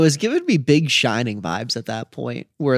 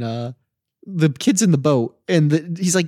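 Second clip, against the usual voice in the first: ordinary talk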